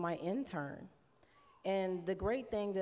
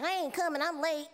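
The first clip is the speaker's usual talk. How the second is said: high, louder breezy brush-off; bandmate Lisa's excuse; 9 semitones higher, 4 dB louder than usual. Retold or imitated. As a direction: toss it off high like a late bandmate. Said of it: "Lisa would be over there like".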